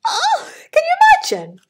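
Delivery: high, loud delighted cry; excited high exclaim. Real, not acted. Reader thrilled by the frog, high excited cry. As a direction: squeal it out with delight.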